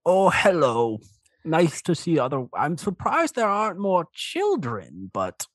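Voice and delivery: put-on high-pitched elderly dragonborn with a European accent; old dragonborn man. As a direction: greet them as a high-voiced old uncle. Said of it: "and the old man just goes".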